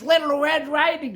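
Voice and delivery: the wolf fakes a sweet girl at the door; wolf faking the girl.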